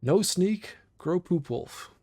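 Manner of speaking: loud, high name reveal, savoring it; proud announcement. Acted. Streamer proudly announcing his burglar's new name, loud and high. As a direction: present the name like a champion's title.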